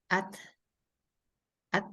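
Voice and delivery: "at" stopped short on the t; suara tertahan. Shown as a demonstration. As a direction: hold the sound back at the t of "at". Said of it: "Dia tertahan ya di". ("at" dengan suara tertahan di huruf t)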